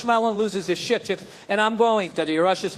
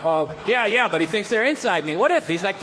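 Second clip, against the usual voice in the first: ordinary delivery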